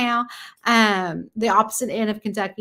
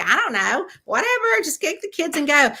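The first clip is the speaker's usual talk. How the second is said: high, airy brush-off of the question; breezy dismissal; 8 semitones higher than usual. Retold or imitated. Wife shrugging off husband's question about books, airy and high. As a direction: wave it away lightly, pitch jumping up. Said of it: "And I'm like".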